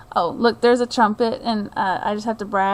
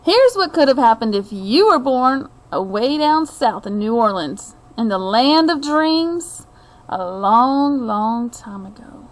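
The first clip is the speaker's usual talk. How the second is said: unhurried storybook reading voice; story narration; 6 dB louder, slower than usual. A narrator's reading. Reading a picture book to viewers, storyteller's narration. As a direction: draw the listener into a tale of old New Orleans.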